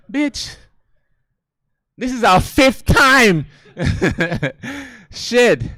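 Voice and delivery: high, incredulous, slowed outrage; exasperated outburst. Retold or imitated. Comic boyfriend protesting a fifth round, high and incredulous. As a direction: protest in disbelief, stretched out.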